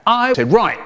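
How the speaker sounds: plain reading voice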